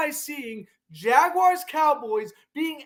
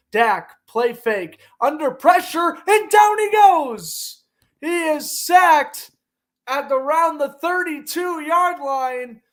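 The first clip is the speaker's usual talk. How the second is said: rising to a loud, higher shout on the sack; excited play-by-play; 4 semitones higher, 6 dB louder than usual. Acted. Commentator calling the Cowboys sack for viewers, excited. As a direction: call the sack like it is live.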